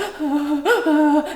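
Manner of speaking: high, gasping mock sobs; imitated baby crying. Daughter in so much pain, imitated gasping cry. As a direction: mimic a worn-out child's breathy wailing. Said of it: "So she's crying, but she's like".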